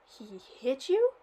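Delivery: put-on old man astonished at his granddaughter. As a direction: act stunned. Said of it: "Wood was astonished".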